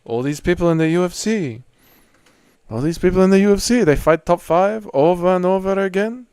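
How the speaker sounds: mock French accent with choppy cadence. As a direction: put on a hammy French fighter's accent. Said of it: "Your French accent sounds like".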